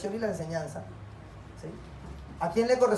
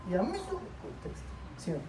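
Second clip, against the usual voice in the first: lightly voiced mother, higher and quieter; mock scolding mother; 6 semitones higher, 10 dB quieter than usual. Mother forbidding back talk, lightly acted high scolding. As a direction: imitate mom laying down the law, pitched up. Mother retold disciplining the child.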